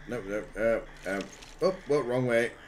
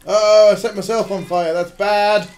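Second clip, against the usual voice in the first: raised, louder dismayed exclamation; mild alarm; 5 semitones higher, 6 dB louder than usual. Real, not acted. Player noticing they set themselves on fire, mild alarm. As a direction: react with contained alarm, a bit loud.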